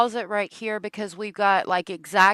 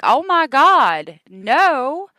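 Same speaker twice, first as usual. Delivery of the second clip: horrified cry; 7 semitones higher, 11 dB louder, much slower than usual. Retold or imitated. Horrified at dying turkey.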